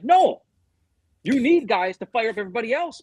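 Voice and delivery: retold outburst, high and loud on the first word; emphatic refusal. Player answering teammates who calm him, emphatic. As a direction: jump in high, pushing back as the player.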